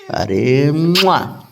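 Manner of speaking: long drawn kissy coo. Real, not acted. Father cooing a slow kiss at his sulking baby. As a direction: stretch it out like a kiss.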